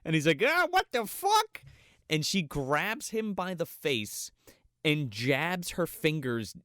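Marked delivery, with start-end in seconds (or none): 0.4-1.6 much higher, slow panicked cry; terrified scream. Retold or imitated. Man attacked by the demon, high panicked scream. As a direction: shriek it in horror.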